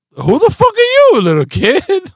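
very high, loud squawk of disbelief; mock incredulous outburst. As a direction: play up outraged astonishment at a child. Mock reaction to his bossy daughter.

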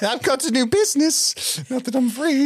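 high squeaky elf with a laugh in it; Dobby impression. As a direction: squeak like a little elf salesman. Dobby boasting of his new business.

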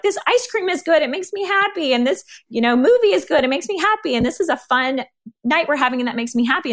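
high, bright-eyed gushing over small joys; bubbly gushing. Retold or imitated. Speaker mimics how people praise everyday things, gushing high. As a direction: gush about ice cream and movies like a kid. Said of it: "every other kind of good we talk about is like".

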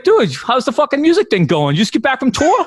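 high, chummy Boston barfly voice; Boston bar buddy. Retold or imitated. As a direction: pitch it up like an eager drinking buddy. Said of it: "he's like".

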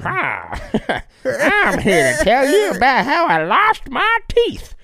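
high, slow, giggly Appalachian hillbilly voice; laughing hillbilly accent. Pretend. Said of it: "moonshiner accent".

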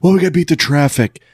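lightly voiced departing fan; imitated early leaver. Podcaster mimicking fans who leave games early, imitated early leaver.

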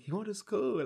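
put-on high childlike reply; child answering. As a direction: answer like a little sibling. Sibling voiced answering mother.

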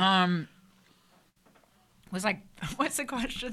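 talking with a mouth full. Real, not acted. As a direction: speak through a mouthful of food. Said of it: "I keep stuffing my face".

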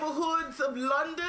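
high, sing-song fake Cockney; badly faked Cockney sliding toward Jamaican; sort-of Cockney. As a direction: ham up a terrible old London accent. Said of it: "It's sort of Cockney".

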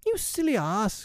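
very high-pitched fairy outburst; passionate cry. A narrator's reading. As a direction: cry out in a high, small fury. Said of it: "cried Tinker Bell in a passion".

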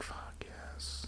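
whispered under the breath; a secret victory hiss of joy; whispered triumph. Retold or imitated. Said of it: "And you're like".

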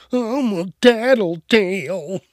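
slow, lightly voiced whiny kid; mock tattletale. Mocking the snitching kid, slow whiny voice. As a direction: drag it out like a smug snitch.

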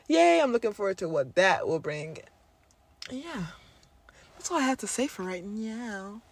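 ordinary talk

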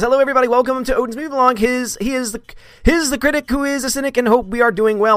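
high mock host voice; mimicking his intro. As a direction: parody his own opening. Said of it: "doing my intro for me".

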